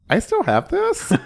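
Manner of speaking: high-pitched mock disbelief with laughter; retold astonishment. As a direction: squeak it as an amused, astonished question. Retelling own surprise at a find.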